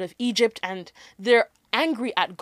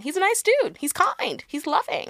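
higher-pitched, lilting concession; mock agreeable; 6 semitones higher than usual. Conceding that everybody calls Jesus a nice dude, mock agreeable. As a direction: recite the compliments in a high lilt.

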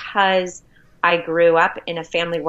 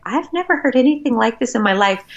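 amazed; 6 semitones higher than usual. Retold or imitated. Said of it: "And I was like".

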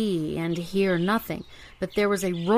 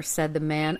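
plain reading voice

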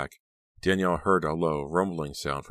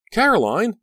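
very high, loud scream of a name; piercing, panicked cry; terrified shriek; 9 dB louder than usual. A narrator's reading. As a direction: shriek to reach a friend. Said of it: "Danielle shrieked".

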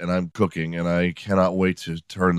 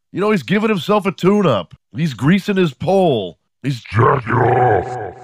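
exasperated police caller; 6 semitones higher than usual. Acted. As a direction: spell it out to the dispatcher, more and more worked up. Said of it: "call the police and be like".

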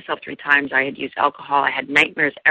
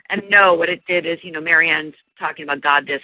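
ordinary talk, nothing marked

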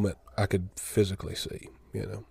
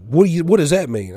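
indignant demand; 7 semitones higher, 7 dB louder than usual. Retold or imitated. Grandma demanding an explanation.

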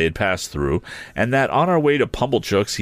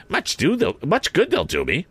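higher, tart voice; grudging sister; 9 semitones higher than usual. A narrator's reading. Sister dismissing the compliments, tart. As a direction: sniff at it.

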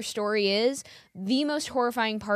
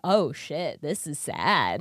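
lower, slow mock sympathy; mocking pity; 7 semitones lower, much slower than usual. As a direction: droop the voice in pretend pity. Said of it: "They're like".

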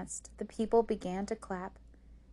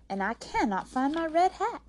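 high, loud, exasperated wail; upset complaint; 9 semitones higher, 7 dB louder than usual. A narrator's reading. May May has lost her hat before singing, upset. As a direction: complain in rising frustration.

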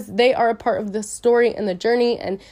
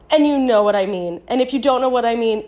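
usual speaking voice

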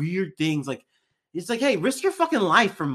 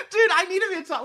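high, loud burst of laughter into words; laughing hard; 8 dB louder, much faster than usual. Real, not acted. Laughing at his friend's big-step joke. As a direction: crack up, then try to talk.

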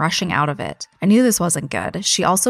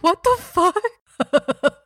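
much higher, drawn-out shocked what the fuck; stunned disbelief; 12 semitones higher, much slower than usual. Real, not acted. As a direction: stretch it out in pure disbelief. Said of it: "That's why I'm like".

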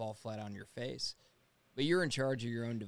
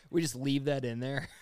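excited joke; 4 semitones higher, 8 dB louder than usual. Real, not acted. Joking about the edit.

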